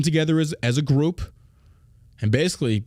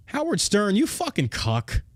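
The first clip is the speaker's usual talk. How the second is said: ordinary delivery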